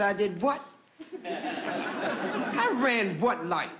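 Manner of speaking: drawn-out, incredulous challenge; indignant disbelief. Acted. Lulu answers the policeman who accused her, comically indignant disbelief. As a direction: act affronted, stretching the question out.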